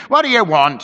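sharp, loud housekeeper's demand. Acted. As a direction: snap the question at him from the doorway. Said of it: "she demanded".

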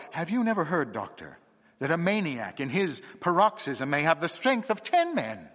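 a feverish case laid out point by point; feverish excitement. Acted. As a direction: argue it out in a fever of discovery. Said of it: "he cried feverishly".

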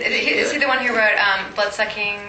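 ordinary talk, nothing marked

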